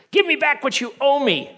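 high, loud, furious demand for the money. Acted. Servant choking his debtor, furious high shout.

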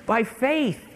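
FAITH high and loud; emphatic preaching. Real, not acted. Preacher driving the verse home, emphatic on FAITH.

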